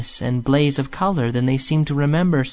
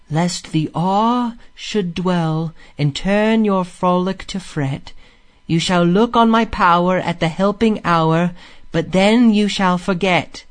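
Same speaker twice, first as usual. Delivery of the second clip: incantatory verse recital; 5 semitones higher than usual. A narrator's reading. Rat relays the song's words to Mole, incantatory. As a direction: recite it as a mystic voice heard in music.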